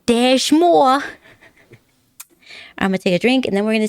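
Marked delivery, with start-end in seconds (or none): 0.1-1.0 loud, high salesman voice; hammed-up infomercial host; infomercial pitch. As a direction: sell it like a TV ad. Infomercial catchphrase.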